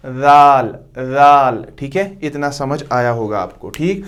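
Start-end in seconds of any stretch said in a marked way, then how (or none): 0.1-1.6 जाल said louder, tongue tip between the teeth; dhal drill. Shown as a demonstration. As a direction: model जाल loudly for the class. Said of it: "इसके बीच में जाल".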